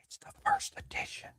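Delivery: breathy whispered aside; whispered aside to the viewers. Pretend. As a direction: hush it like a secret. Whisper heard.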